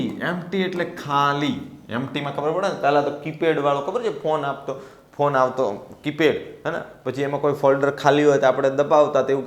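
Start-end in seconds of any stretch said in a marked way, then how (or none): none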